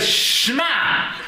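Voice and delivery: a high, stretched "Shma", half laughing; drawn-out Shema. Retold or imitated. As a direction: draw out the Shema like a slow brisker. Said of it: "He was saying the Shema".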